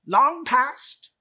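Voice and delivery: high, loud nervous miser; stammering old Scrooge. Acted. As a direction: Scrooge stammers before the spirit.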